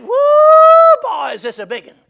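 storyteller imitating an excited hollering climber; retold holler. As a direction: whoop it down from a treetop. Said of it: "He hollers down at him".

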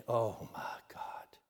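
slow, breathless whisper; awed whisper. Real, not acted. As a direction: whisper it, overcome by the memory. Overcome while retelling deathbed scene.

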